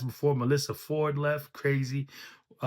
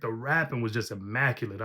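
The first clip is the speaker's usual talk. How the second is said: usual speaking voice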